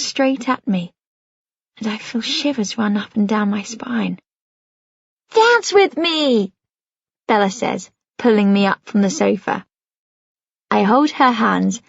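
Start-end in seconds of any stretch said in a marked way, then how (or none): 5.3-6.5 put-on little girl voice. Little Bella pulls Penny up.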